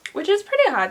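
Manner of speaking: high, loud impressed tone; pleased. Real, not acted. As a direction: sound pleasantly surprised. Pleased surprise.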